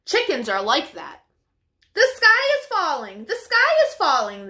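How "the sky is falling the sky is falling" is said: frantic cry. Acted. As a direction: cry out the alarm like flustered hens. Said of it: "they cried".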